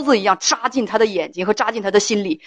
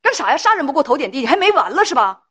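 higher-pitched mock nagging wife; indignant acted scolding, pitched up; hands-on-hips scolding; 6 semitones higher than usual. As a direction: imitate a wife telling off her husband. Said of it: "掐着腰跟你说".

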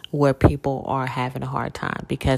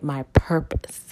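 whispered. Real, not acted. Stating her purpose.

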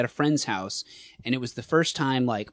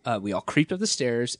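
ordinary talk, nothing marked

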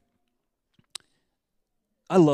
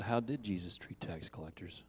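gentle repeat; 4 semitones lower, 5 dB quieter than usual. Retold or imitated. Speaker gently repeats his question to the crying woman, low and unhurried. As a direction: ask it again softly, letting it sink in.

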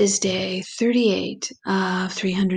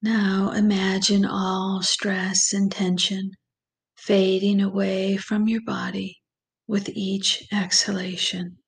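slow, calm meditation voice with long pauses; guided meditation; slower than usual. Acted. Guide leads listeners through breathing and visualization, slow meditation voice. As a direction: ease the listener gently into relaxation, unhurried and even.

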